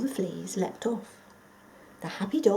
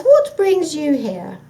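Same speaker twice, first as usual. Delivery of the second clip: higher, slow mock innocence; feigned surprise; 8 semitones higher, 13 dB louder, slower than usual. The fox feigning surprise at the dog's visit. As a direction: play innocent. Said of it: "feigning surprise".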